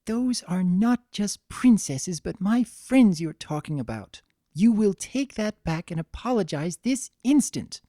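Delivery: freeze him with every word; icy. Acted. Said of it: "Frost coated her words".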